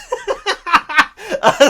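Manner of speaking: loud, high burst of laughter; real, high, loud teary laugh; tearful laugh. Real, not acted. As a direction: laugh out the leftover tears. Why I was in tears.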